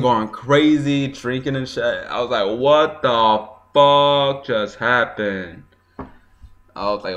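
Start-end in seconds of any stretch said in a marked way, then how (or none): 2.6-5.6 stunned disbelief. Retold or imitated. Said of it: "everyone was yelling".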